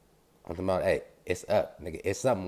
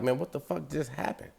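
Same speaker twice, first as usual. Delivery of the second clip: high, softer voice of disbelief; stunned; 7 semitones higher, 6 dB quieter than usual. Retold or imitated. Driver stunned after the crash asks himself, high and hushed. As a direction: replay a dazed reaction, pitched up and quiet. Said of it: "I'm sitting there stunned".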